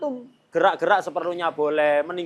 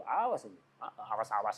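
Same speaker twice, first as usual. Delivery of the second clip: mock threat; 8 semitones lower, 10 dB quieter than usual. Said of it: "Ngomong toh orang itu".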